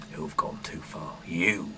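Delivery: ordinary talk, nothing marked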